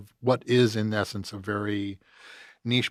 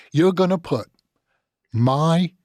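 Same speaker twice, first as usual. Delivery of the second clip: higher, louder and slow British outrage; outraged British agent; 5 semitones higher, 4 dB louder, slower than usual. Retold or imitated. British agent indignant at his client flying coach, louder. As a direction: drag out the outrage in a British accent. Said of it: "he goes".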